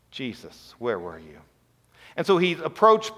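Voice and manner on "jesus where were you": much softer and lower; a quiet broken plea; hushed lament. Retold or imitated. Grieving sisters asking Jesus where he was, hushed.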